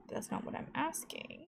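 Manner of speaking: a quick hushed aside to herself; whispered aside. Real, not acted. Gamer whispering while checking the fridge. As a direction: whisper it under your breath.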